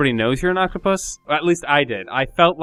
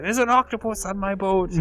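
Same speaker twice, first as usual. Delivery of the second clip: higher-pitched, hammed-up fake French accent; bad French accent; 7 semitones higher than usual. French chef sniffing out the octopus, in a mock French accent. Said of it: "a really bad French accent that they're doing".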